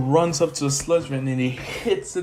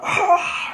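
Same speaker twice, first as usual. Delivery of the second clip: much higher breathy shriek of pain; 4 dB louder than usual. Retold or imitated. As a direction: shriek as the punch lands. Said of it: "shrieking in pain".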